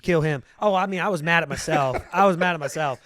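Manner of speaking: half-laughing loud outburst about the rival; heated outburst. Retold or imitated. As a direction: burst out as if the loss just happened. Retelling rage at losing race.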